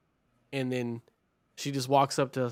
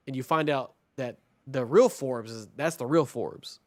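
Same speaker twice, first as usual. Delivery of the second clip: ordinary talk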